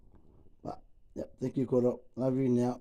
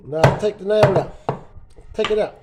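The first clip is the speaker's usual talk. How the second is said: loud, high urging to pull the nail; calling out; 6 semitones higher, 8 dB louder than usual. Real, not acted. Father urging his son to pull the nail, loud.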